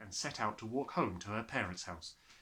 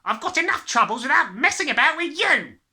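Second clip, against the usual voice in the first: snapped; 15 dB louder than usual. Acted. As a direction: snap at the child impatiently. The father's voice.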